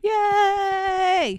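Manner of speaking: high loud yay; cheering. Real, not acted. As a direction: cheer for the wedding. Cheering the wedding.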